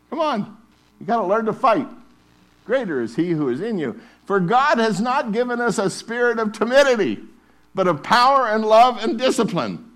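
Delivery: loud, high, repeated "it is written"; preaching at full force. Acted. Preacher urging the church to wield scripture, full force. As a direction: chant it like a battle cry.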